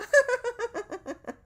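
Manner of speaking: loud, high mock stylist laugh; a shrill-free, high laughing burst; high burst of laughter. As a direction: imitate her sudden high laugh.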